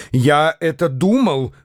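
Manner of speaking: plain reading voice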